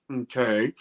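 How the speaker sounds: ordinary delivery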